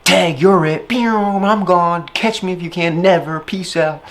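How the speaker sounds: playful tag taunt. Pretend. Acting out a game of tag.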